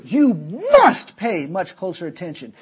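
"must" punched loud; emphatic command. Real, not acted. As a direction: drive home "must" to the believer. Preacher hammers the word must.

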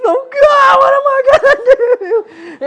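very high, loud, slow wail; mock bawling. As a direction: cry out helplessly, hammed up. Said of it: "I'm crying on my bed sheets".

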